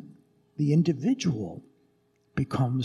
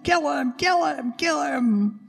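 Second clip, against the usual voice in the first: high shouted chant; crowd yelling; 12 semitones higher than usual. Acted. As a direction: yell it like the arena crowd. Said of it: "people scream and yell".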